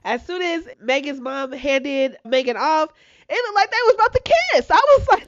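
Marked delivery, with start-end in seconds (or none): 3.3-4.7 excited. Real, not acted. Excited retelling, pitch up.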